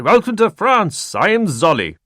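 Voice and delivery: put-on beam out the greeting in an Eastern European accent. Warner man greeting Stahl eagerly, Eastern European accent. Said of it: "His accent was from somewhere well east".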